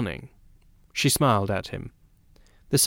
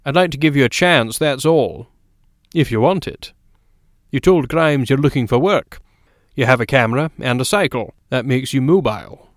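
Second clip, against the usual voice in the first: louder, firm offer of a trial; McGovern, decisive newspaperman; 3 semitones higher, 5 dB louder than usual. Acted. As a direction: lay out the offer firmly, voice raised.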